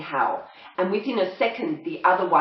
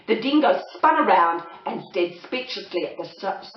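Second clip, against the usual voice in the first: ordinary delivery, nothing marked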